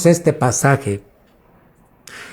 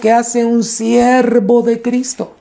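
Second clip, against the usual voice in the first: high, sustained preaching pitch; fervent preaching; 6 semitones higher than usual. Real, not acted. Preacher asking the church what a servant of Christ does, fervent high exhortation. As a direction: press the point home, pitched well above normal talk.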